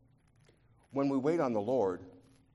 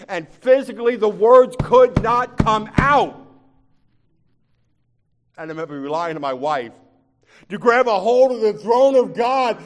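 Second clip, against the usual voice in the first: much higher, much louder and slow, ringing preacher cry; preaching at full force; 12 semitones higher, 16 dB louder than usual. Acted. Preacher recalling praying with his wife, crying out loud and slow. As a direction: pour it out at full force like a plea.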